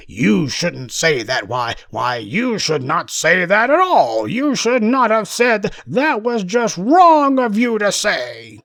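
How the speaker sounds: slow, drawn-out mocking voice, climbing high; hammed-up offence, rising to a high-pitched reproach; mock indignant objector. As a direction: imitate a scandalized scolding, stretching the words. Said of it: "he apparently felt that that was somehow".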